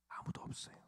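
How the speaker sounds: barely audible, breathy whisper; whispered repeat. Real, not acted. Preacher telling congregation nobody can, whispered. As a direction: let it fade into a whisper.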